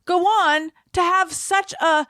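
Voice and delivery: high, incredulous outcry; mock disbelief. Learning Meryl Streep was homecoming queen, mock disbelief high. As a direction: act baffled, pitch rising.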